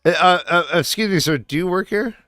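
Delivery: lightly played customer asking for help; store customer. Acts customer in improvised store scene.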